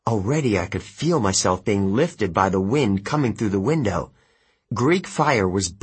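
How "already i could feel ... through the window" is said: ordinary delivery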